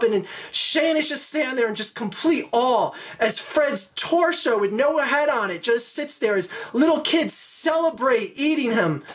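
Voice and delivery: ordinary talk, nothing marked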